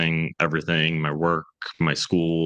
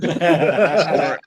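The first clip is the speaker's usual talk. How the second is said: laughing; 10 semitones higher, 5 dB louder than usual. Real, not acted. Laughing while cutting in.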